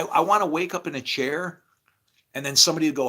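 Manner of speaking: usual speaking voice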